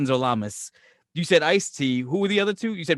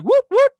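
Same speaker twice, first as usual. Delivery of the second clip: high, loud mock siren whoops; imitated police siren; 9 dB louder than usual. Cops arriving for Todd, imitated siren whoops. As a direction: whoop like a cop car pulling up.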